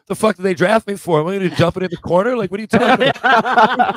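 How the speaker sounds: high, loud, fast and laughing disbelief; incredulous outburst. Retold or imitated. Rookie protesting to his bench, loud laughing outrage.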